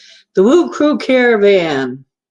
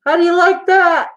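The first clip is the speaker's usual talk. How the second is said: silly filter voice; 12 semitones higher, 5 dB louder than usual. Pretend. Showing off a face filter.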